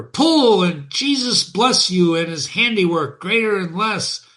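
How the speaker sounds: a driver's happy shout to his horse; driver yelling. Acted. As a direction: cheer the horses on, loud and pleased. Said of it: "yelled the driver".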